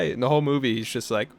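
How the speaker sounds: ordinary delivery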